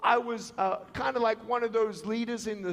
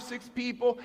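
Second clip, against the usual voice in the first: usual speaking voice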